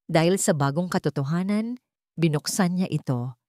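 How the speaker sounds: ordinary delivery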